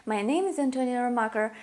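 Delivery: usual speaking voice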